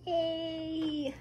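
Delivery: high, happy cheer; little cheer. Real, not acted. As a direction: cheer yourself on. Fixed the door.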